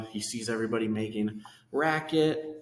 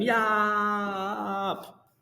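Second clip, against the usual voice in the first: high, louder mock shout; shouted yop; 9 semitones higher, 4 dB louder than usual. Retelling Jojo's shout from the tower, high shout. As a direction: yell it out like the small boy. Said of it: "he's shouting".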